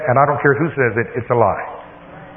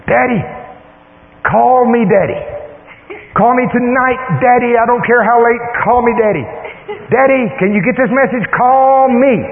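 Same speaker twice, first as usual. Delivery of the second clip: high, urgent pleading, much higher than usual; excited daughter; 9 semitones higher than usual. Retold or imitated. Daughter leaves dad a phone message, excited and high-pitched. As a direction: imitate an excited daughter begging for a callback. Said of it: "She's all excited".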